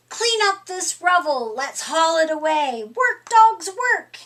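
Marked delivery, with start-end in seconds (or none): 2.8-4.2 higher, louder call to work; rallying call. Acted. Foreman urges the dog crew to clear rubble, calling high and loud. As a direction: cheer the dogs on to keep working.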